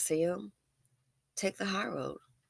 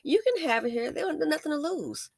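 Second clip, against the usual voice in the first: much higher, soothing put-on voice; calming someone down; 11 semitones higher than usual. Shown as a demonstration. Showing viewers how to calm a hothead, high and soothing. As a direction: placate the angry fool sweetly. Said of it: "Sometimes it's best like this".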